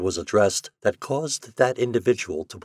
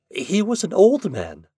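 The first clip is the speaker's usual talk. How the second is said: put-on flustered young woman; 11 semitones higher, 4 dB louder than usual. A narrator's reading. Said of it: "she stammered".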